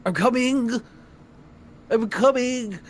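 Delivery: very high, loud distant shout; squeaky little man yelling; Spriff calling out. Acted. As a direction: holler from the back room. GM voices Spriff calling from back.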